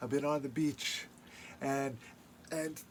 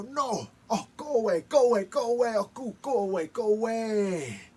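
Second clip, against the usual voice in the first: spirits shooing Raven; 8 semitones higher, 7 dB louder than usual. Acted. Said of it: "And they go".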